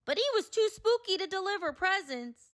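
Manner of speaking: ordinary delivery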